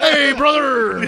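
high, very loud wrestler holler; hammed-up wrestler, half laughing; Hulk Hogan. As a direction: belt it out as a wrestling greeting. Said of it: "Or Hulk Hogan".

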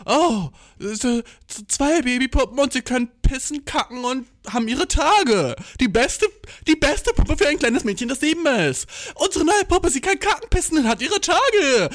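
high, peppy toy-ad announcer; toy commercial. Retold or imitated. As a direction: pitch it like a cheesy kids' ad. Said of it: "es war immer so". (hohe, überdrehte Spielzeugwerbungsstimme)

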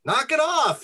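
high, exasperated call. A narrator's reading. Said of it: "called out".

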